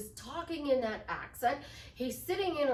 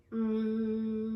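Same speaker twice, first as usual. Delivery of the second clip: meditation hum; 2 semitones lower than usual. Shown as a demonstration. Coach showing how to manifest somebody, droning meditation hum. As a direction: drone a steady meditative "mm". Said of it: "I could sit here and go".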